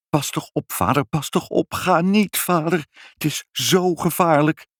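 high, pleading warning; anxious warning. Acted. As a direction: beg him urgently to stay back. Family begs father not to go.

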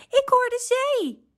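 hoge, verraste uitroep; opgewonden. A narrator's reading. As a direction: roep het hoog uit van opwinding. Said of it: "zei hij opgewonden". (opgewonden, hoog kikkerstemmetje)

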